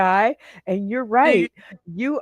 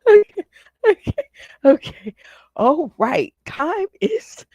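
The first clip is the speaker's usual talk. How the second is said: laughing; 10 semitones higher, 6 dB louder than usual. Real, not acted. Host recovering from laughing.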